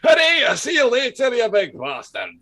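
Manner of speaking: put-on loud, high generic Scottish accent shout. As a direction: bellow it like a Scottish comic, in broad Scottish. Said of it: "You haven't done your generic Scottish accent".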